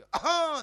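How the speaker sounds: big loud gravelly hack; old man clears throat. Retold or imitated. Old man clearing his throat at the car window, loud hack. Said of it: "એ કે".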